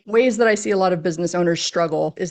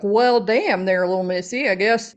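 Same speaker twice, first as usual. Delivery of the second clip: put-on Southern accent. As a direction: play a mock Southern cowboy answering a silly question. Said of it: "I barely have a Southern accent".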